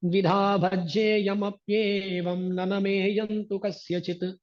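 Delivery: a lightly voiced defiant Ravana chanting Sanskrit; Ravana's verse recited. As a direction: intone the proud king's verse. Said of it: "वो बोलता है कहीं".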